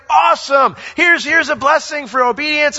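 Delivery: high, loud and cheery; the Lord delighted. Retold or imitated. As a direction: hand over the blessing with glee. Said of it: "the Lord's like".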